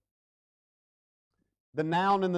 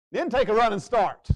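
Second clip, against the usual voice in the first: preaching shout; 5 semitones higher, 7 dB louder than usual. Acted. Preaching climax.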